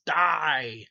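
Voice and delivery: screamed Freddy snarl; Freddy's shout. Retold or imitated. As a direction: bark the kill word like a slasher villain. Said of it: "and goes".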